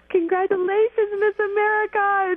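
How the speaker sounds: high and loud, bursting with joy; excited congratulations. Retold or imitated. Calling the new Miss America, thrilled and high-pitched. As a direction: gush it like you can't contain yourself.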